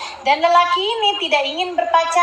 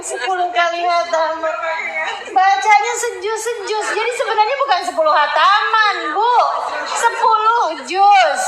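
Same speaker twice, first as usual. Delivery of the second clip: mock boast; 6 semitones higher than usual. Mocking the ten-khatam claim.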